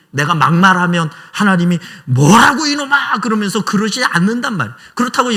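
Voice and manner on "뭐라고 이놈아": higher, louder, slow scolding roar; imagined God thundering back at a rude prayer; mock angry rebuke. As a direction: play a stern elder snapping at a brat. God scolding an insolent believer.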